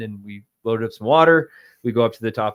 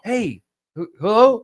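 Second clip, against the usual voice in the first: high, louder questioning hello; answering the phone; 11 semitones higher, 7 dB louder than usual. Retold or imitated. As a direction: pick up a call, pitched way up. Retold answering of phone call.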